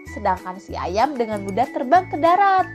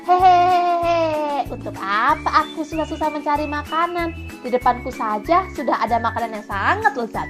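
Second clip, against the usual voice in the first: sly chuckle, then gloating aloud; villainous monkey plotting a meal; scheming monkey; 3 semitones higher than usual. Acted. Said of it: "pikir kera".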